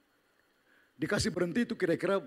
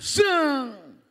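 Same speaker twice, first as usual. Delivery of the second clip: whoosh sound; 12 semitones higher, 9 dB louder than usual. Retold or imitated. Coaster drop sound.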